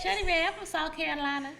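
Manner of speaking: high-pitched mock South Carolina accent; imitating South Carolina accent. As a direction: tease with a put-on South Carolina twang. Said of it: "That's how you sound".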